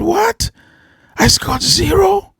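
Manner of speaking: high, astonished protest; boy can't believe his grade; shocked disbelief. Acted. Boy to teacher, shocked high disbelief.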